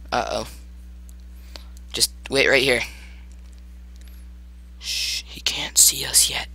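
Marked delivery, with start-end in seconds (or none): 4.8-6.5 slow whispered shushing; hushed whisper. Pretend. Player hiding from the monster, hushed whisper. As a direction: whisper it, keeping out of sight.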